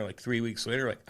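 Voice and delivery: ordinary delivery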